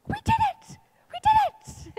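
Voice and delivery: very high, loud victory squeal; exuberant. Retold or imitated. Re-enacting her wedding cry to her groom, exuberant. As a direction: shriek it with over-the-top glee. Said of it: "really exuberant".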